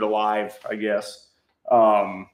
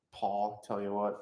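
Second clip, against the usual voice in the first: ordinary talk, nothing marked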